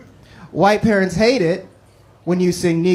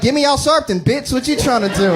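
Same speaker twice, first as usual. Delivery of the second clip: mock yell back; 6 semitones higher than usual. Adopted kid yelling back at dad, mock defiant. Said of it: "I'm like".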